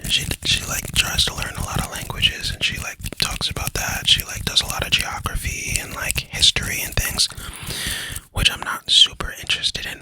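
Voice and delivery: hushed, soft asmr whisper. Acted. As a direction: whisper gently right into the mic. Long soft-spoken ASMR stretch.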